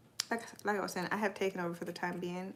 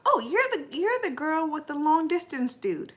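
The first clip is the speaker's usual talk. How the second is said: high, loud sudden recognition; light up as you remember her; surprised recognition; 8 semitones higher, 10 dB louder than usual. Real, not acted. Recognizing a viewer's name.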